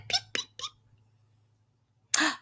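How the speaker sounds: very high, soft peeps; short, squeaky chick cheeps; baby chick. A narrator's reading. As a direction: peep like a tiny chick. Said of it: "Baby chick said".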